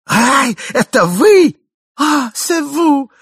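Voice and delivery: high, loud, gushing delight; joyful cry. Acted. Wife greets her husband, loud feigned joy. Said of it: "закричала ... с неподдельной радостью".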